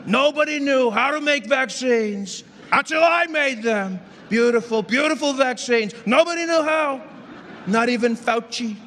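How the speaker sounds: high slow boastful Trump voice; slow bragging Trump impression. Impersonating Trump.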